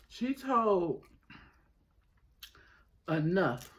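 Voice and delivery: usual speaking voice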